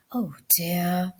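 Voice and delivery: soft, low worried murmur. Acted. Mother murmuring over feverish son's bed, soft and worried. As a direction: barely say it over the sick child. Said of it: "she murmured".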